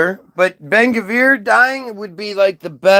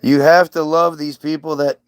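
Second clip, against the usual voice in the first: usual speaking voice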